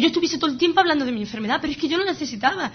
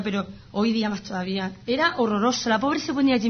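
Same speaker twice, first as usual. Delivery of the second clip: usual speaking voice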